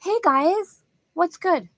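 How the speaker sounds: chirpy son greeting parents on the phone; imitating son. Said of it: "what Dominic says every time he calls us".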